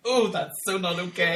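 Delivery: imitating someone put off; acted-out uneasy reaction. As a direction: voice an exaggerated uneasy ooh. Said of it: "That was like".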